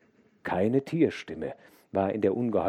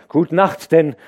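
loud, higher call over the shoulder; calling back; 9 semitones higher, 14 dB louder than usual. A narrator's reading. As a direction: shout goodnight while trotting off. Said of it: "rief der Tagelöhner zurück".

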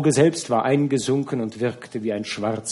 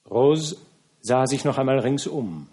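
usual speaking voice

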